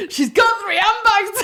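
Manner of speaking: high, loud wail, half laughing; re-enacted hysterical complaint; exasperated outburst. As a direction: cry out in comic despair. Exasperated outburst to security woman.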